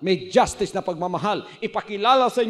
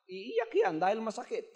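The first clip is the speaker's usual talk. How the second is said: ordinary talk, nothing marked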